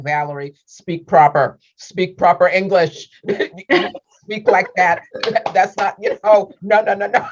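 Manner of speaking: high, loud scolding, half laughing; culture-shocked mom. Retold or imitated. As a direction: imitate a mother correcting her child in dismay. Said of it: "my mom would be having this culture shock".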